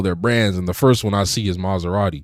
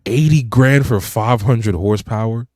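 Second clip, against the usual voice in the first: loud, slow, higher-pitched disbelief; real incredulity; 4 semitones higher, 9 dB louder, slower than usual. Real, not acted. Co-host balking at the price of a car, incredulous. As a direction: spell out the outrageous deal, louder and slower.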